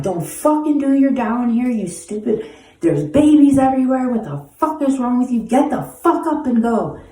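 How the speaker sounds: high, strained furious yelling; angry yelling. Retold or imitated. Yelling at a stranger doing drugs near babies, furious. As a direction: scold her hard at the top of the voice.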